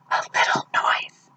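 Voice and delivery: breathy, voiceless rabbit whisper. Acted. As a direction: whisper it softly. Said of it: "whispered the rabbit".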